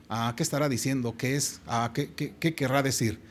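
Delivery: soft, wondering crowd muttering to themselves; puzzled murmur. Retold or imitated. La gente queda como, pensando. (murmullo de gente desconcertada)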